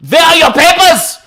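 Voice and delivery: high, loud barked demand; barking Gestapo officer. Acted. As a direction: bark it like a secret police officer.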